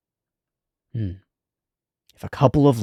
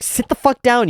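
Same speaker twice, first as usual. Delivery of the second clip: angry yell; 5 dB louder than usual. Retold or imitated. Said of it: "Chilchuk's first move is like".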